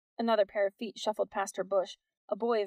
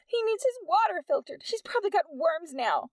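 high, whiny lament for the cat; moaning; 9 semitones higher, 4 dB louder than usual. A narrator's reading. Peg lamenting her lost cat, moaning high. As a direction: moan it pitifully. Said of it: "Peg moaned".

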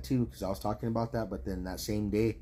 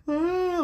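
high squealing freak-out cry; mock panicked scream; 7 dB louder than usual. Retelling the crying woman in the movie, a high mock shriek. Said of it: "like, all freaking out".

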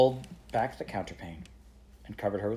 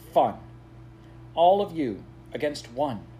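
bitter; 5 semitones higher, 7 dB louder than usual. Acted. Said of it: "Kizzy said it bitterly".

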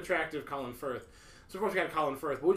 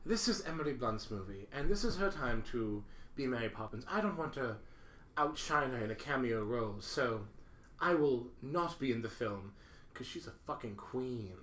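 put-on soft, low gracious older lady; Julie Andrews voice; 7 semitones lower, 8 dB quieter than usual. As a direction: gently decline like a refined grande dame. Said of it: "she basically was like".

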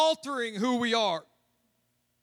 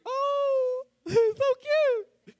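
put-on squeaky high cooing at a baby; biker's voice jumps high cooing over a baby. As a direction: coo like a tough biker melting. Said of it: "His voice will go up for it".

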